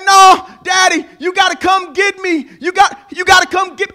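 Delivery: high, loud, desperate shouting; terrified daughter pleading for rescue; frantic begging. Retold or imitated. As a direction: plead with daddy to come right now.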